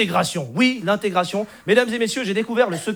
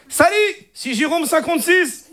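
put-on high-pitched Moroccan Arabic accent; caricatured North African accent, pitched up; 5 semitones higher than usual. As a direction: pose as French with a thick Moroccan accent. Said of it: "l'accent arabe".

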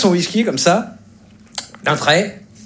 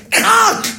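sharp high-pitched "crac"; explosive cracking noise; imitated snapping crack. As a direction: voice wood breaking with a sudden crack. Imitates stool bars snapping.

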